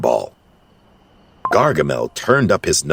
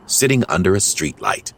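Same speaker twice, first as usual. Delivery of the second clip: ordinary delivery, nothing marked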